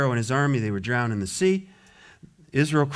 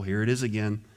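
ordinary talk

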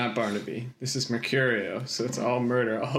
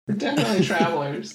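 mock insistent; 7 semitones higher, faster than usual. Player joking in character.

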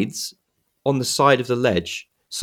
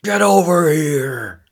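higher, slow drawn-out war cry; Scorpion battle cry; 8 semitones higher, 7 dB louder, slower than usual. Retold or imitated. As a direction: bellow it slowly like a masked ninja. Quoting Scorpion's catchphrase.

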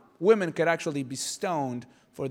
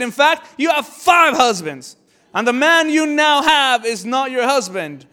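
loud, high, slow proclamation; emphatic revelation; 7 semitones higher, 10 dB louder, slower than usual. Acted. Jesus confronts the woman about her husbands, emphatic shout. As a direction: shout it out slowly, landing each word.

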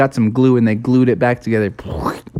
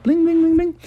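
plain reading voice

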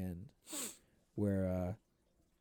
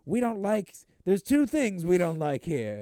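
put-on high, loud old-movie tough guy; tough guy bartender; 7 semitones higher, 6 dB louder than usual. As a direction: bark it slowly as a hammed-up barman. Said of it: "the tough guy bartender".

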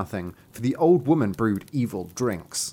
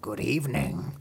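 growled; 5 dB quieter than usual. Acted. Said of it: "she growled".

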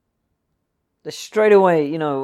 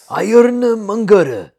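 high, loud, drawn-out proclamation; slow, hammy shout; booming villain name; 9 semitones higher, 13 dB louder, much slower than usual. Acted. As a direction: belt out the name theatrically, stretching it. Dramatic announcing of villain's name.

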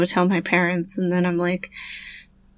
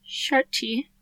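softly said British "Shirty"; British accent; 3 semitones higher, 10 dB quieter than usual. Shown as a demonstration.

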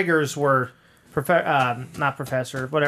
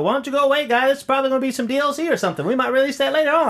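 mock character voice; 10 semitones higher, faster than usual. Quoting game character's line.

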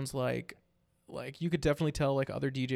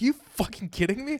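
disbelief; 8 semitones higher, 4 dB louder than usual. Retold or imitated. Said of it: "I was like".

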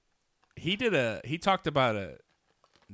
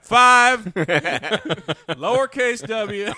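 laughing; 12 semitones higher, 11 dB louder, much slower than usual. Real, not acted. Laughing through the model number.

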